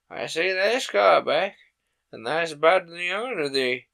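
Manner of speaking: loud rough Yorkshire station master; Yorkshire accent. Acted. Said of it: "pronouncing his words in a strange, broad fashion".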